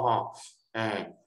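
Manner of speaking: ordinary delivery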